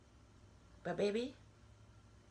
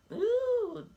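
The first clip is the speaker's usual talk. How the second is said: mock pleasured ooh; 14 semitones higher, 5 dB louder than usual. Recalling the fling that felt good.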